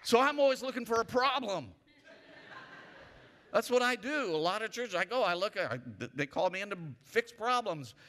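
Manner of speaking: full-force preaching. Acted. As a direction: preach it out to the room.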